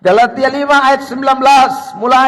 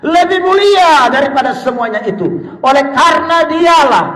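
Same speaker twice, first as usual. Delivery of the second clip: forceful scripture reading; 6 semitones higher, 5 dB louder than usual. Acted. Preacher reading Paul's words to the congregation, forceful preaching. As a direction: proclaim the verse with raised voice.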